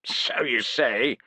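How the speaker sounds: slow, nasal scoff. Acted. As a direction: dismiss him with a slow scoff. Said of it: "scoffed Gerulf".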